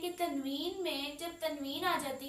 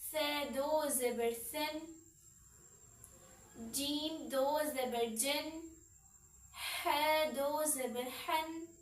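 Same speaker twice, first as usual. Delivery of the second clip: slow drill; slower than usual. Shown as a demonstration. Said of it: "teacher drilling tanween".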